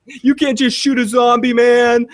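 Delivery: hammed-up protest whine; mock outrage. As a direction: play up fake outrage on behalf of zombies. Mock outrage for zombie rights.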